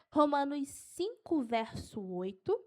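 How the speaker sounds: slow, measured dictation; unhurried verse reference; slow for note-taking. Shown as a demonstration. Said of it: "Eu vou falar devagar".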